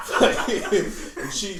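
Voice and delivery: high, loud burst of laughter. Real, not acted. Cracking up while retelling the insult, loud laughter. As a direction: breaks into a big shrieking laugh.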